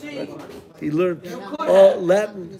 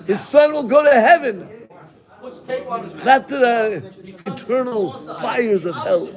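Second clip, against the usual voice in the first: much higher and louder, with a laugh; fervent promise; 5 semitones higher, 5 dB louder than usual. Acted. Priest urging Maria to hand over the boy, fervent. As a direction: sell the promise of heaven with zeal.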